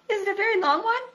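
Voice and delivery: high, excited little voice; a thrilled small friend asking; eager Piglet. A narrator's reading. Piglet eagerly asking Pooh about his new hum.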